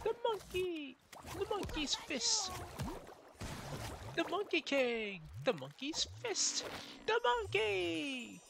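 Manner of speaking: excited falsetto exclamations; high cartoon voice. Gamer quoting knot names from an episode, high cartoon cries. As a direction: cry out each phrase way up high.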